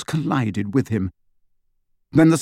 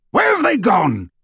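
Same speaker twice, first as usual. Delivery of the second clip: higher, loud shouted question; Hagrid bellowing; 8 semitones higher, 3 dB louder than usual. A narrator's reading. Hagrid asking Harry about the pursuers, loud bellow.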